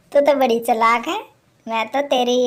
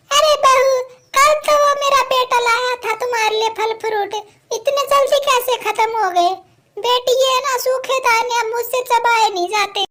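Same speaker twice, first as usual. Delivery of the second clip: put-on a hungry old woman calling through the house; 9 semitones higher than usual.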